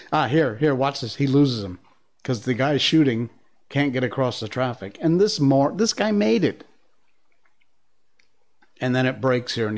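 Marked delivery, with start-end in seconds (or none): none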